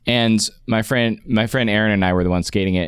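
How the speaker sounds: normal talking voice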